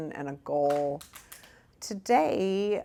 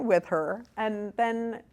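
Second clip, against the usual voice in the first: ordinary talk, nothing marked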